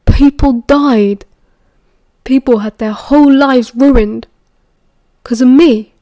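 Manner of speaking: loud, slow and heavy with self-blame; forceful, pained protest; anguished outburst. A narrator's reading. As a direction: hit each phrase hard, bitterly upset. Guilty, heated quarrel with mentor.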